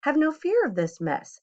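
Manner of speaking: high, breezy Cat in the Hat; cheerful cat. A narrator's reading. Cat in the Hat calms the children, high and breezy. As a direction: reassure them grandly. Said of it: "said the Cat in the Hat".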